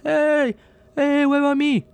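high-pitched little floating spaceman voice; little gingerbread man. Acted. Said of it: "they're just little gingerbread men".

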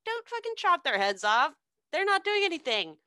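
mock Alice objecting; high pleading protest. Alice protesting in retold story.

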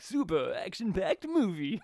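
rising VHS rental trailer read. Retold or imitated. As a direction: pitch it like an old VHS ad. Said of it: "you went for the V H S rental trailers".

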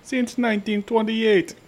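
high, slow Jamaican singsong; mock Jamaican accent. Showing co-hosts how to read the label, mock Jamaican accent. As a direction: stretch it out in a hammed-up Jamaican accent. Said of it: "maybe with an accent".

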